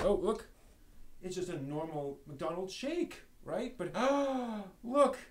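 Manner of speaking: hammed-up amazement at a toy; mock surprise reveal. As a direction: play up the surprise for the viewers. Showing robot hidden in toy shake.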